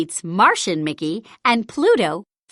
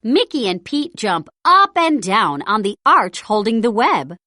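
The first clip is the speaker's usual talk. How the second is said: ordinary delivery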